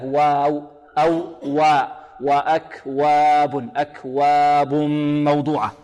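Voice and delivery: slow chanted وا, neither heavy nor over-thinned; true Arabic waw. Shown as a demonstration. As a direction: draw out وأكواب with a natural open wa. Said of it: "الواو هذه العربية هكذا".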